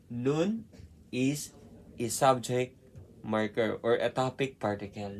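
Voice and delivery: ordinary talk, nothing marked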